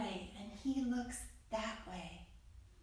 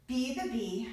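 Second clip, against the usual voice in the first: usual speaking voice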